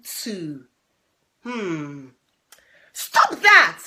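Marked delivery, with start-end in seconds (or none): 2.9-3.8 high loud shout at the bullies. Acted. Little sparrow shouting at the ducklings, high and loud. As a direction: yell it to make them stop. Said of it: "shouted a little sparrow".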